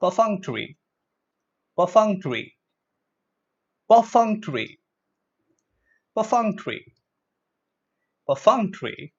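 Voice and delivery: "Perfunctory" as per-FUNK-tuh-ree, falling each time; pronunciation drill. Shown as a demonstration. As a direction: model "Perfunctory" for repeating, with pauses between. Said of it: "say it with me".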